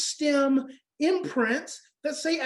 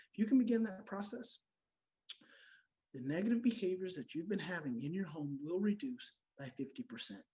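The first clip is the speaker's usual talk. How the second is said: much softer, lower, gentle voice; hushed and soft; 6 semitones lower, 11 dB quieter than usual. Real, not acted. Speaker softly guides parents through self-talk, hushed. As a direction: drop to a hush and soothe.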